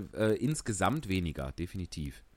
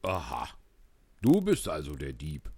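angry farmer; 8 semitones lower than usual. Acted. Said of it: "sagte der Bauer zornig".

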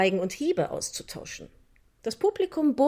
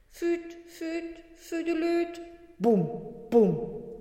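flute and drum imitation; 7 semitones higher, much slower than usual. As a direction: mimic a little fair band with your voice.

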